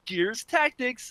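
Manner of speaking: high, slow, smiling announcement; teasing high-pitched presenter; imitated grinning announcer. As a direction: announce it slowly with a big grin. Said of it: "comes out smiling real big".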